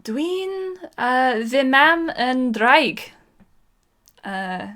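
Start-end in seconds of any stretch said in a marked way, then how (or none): 0.0-3.1 put-on high, lilting Welsh accent; sing-song Welsh lilt, put on. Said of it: "doing the Welsh accent again is painful to me".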